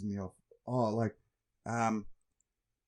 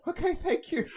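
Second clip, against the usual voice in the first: mock polite. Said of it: "I was like".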